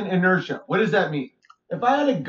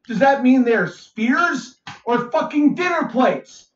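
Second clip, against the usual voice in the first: ordinary delivery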